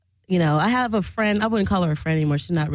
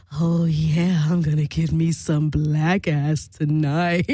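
low, slow lecherous drawl; mock a leering creep savouring his chances; creepy guy; 5 semitones lower, slower than usual. Swinger eyeing the narrator as he sits down, creepy and gloating. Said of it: "looking kinda creepy".

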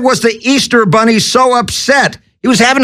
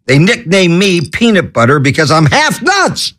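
usual speaking voice

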